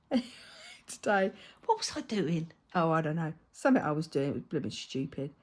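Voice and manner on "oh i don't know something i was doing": normal talking voice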